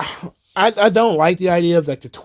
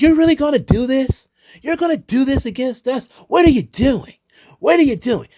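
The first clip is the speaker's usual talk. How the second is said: high-pitched mock yell at an opponent; shrill mocking confrontation, pitched way up; mock yelling wrestler; 8 semitones higher than usual. Wrestler confronting her rival in the ring, high mock yell. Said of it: "yells at her".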